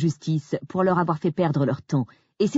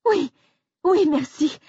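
high, loud, relieved thanks; relieved exclamation; 12 semitones higher, 4 dB louder, slower than usual. Acted. Grace thanking the receptionist, relieved. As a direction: burst out with relief. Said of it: "me suis-je exclamée soulagée".

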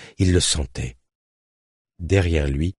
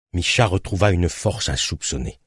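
usual speaking voice

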